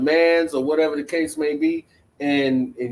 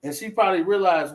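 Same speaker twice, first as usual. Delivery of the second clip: usual speaking voice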